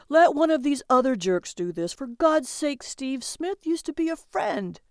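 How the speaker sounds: high, strained plea; urgent pleading. A narrator's reading. Calvin begs his partner not to bid, urgently pleading.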